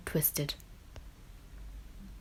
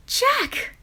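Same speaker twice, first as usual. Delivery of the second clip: much higher, gasped cry of the name; surprised gasp; 4 dB louder than usual. A narrator's reading. Grandma opening the door to Jack, high gasped cry. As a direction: cry his name in sudden joy. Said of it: "gasped Grandma".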